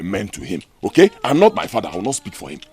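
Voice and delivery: ordinary talk, nothing marked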